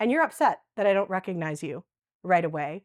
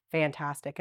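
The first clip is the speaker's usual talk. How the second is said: dry sarcasm; 7 semitones lower, 4 dB quieter than usual. Real, not acted. Sarcastic reaction to text.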